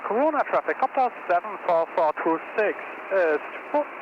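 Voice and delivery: crisp radio transmission voice; radio call. Shown as a demonstration. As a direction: read it like a pilot on the radio. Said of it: "So I'm doing the first radio call".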